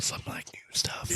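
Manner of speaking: hushed secret whisper. Real, not acted. Host teasing the new bag series in a hushed whisper. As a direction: whisper it like a teaser. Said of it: "So excited".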